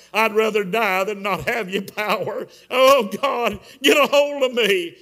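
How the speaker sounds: re-enacted tearful plea building to a shout; crying prayer. Praying against the kitchen wall, crying prayer building loud. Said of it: "I'm in there crying".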